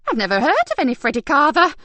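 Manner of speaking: high, loud, too quick; frightened denial. Acted. Carer lying to detectives, scared. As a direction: caught out and lying. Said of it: "her frightened eyes told them everything".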